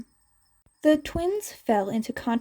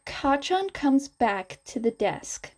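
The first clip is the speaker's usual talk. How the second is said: plain reading voice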